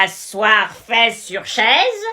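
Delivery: put-on very slow, deliberate baby words; over-slow for a foreigner. Teacher to English student, very slowly. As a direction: spell it out to someone who doesn't understand.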